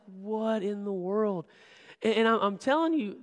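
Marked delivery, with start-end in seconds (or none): 0.1-1.5 slow, drawn-out, higher-pitched wonder; stunned disbelief. Retold or imitated. Youth leader watching his friend greet the server, stunned. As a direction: stretch each word in mock amazement.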